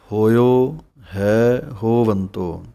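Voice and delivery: ਹੋਇਓ, ਹੈ, ਹੋਵੰਤੋ each set apart by a short gap, slowly; words separated by gaps. Shown as a demonstration. Teacher models for the congregation, ਹੋਇਓ ਹੈ ਹੋਵੰਤੋ with clear gaps. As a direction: pause between ਹੋਇਓ and ਹੈ ਹੋਵੰਤੋ, never run together. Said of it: "ਜਲਦੀ ਨਾਲ gap ਦੇ ਕੇ ਬੋਲਣਾ ਹੈ".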